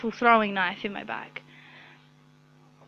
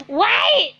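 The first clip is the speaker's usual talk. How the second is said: sudden stop; 9 semitones higher, 4 dB louder than usual. Real, not acted. Sudden interruption to herself.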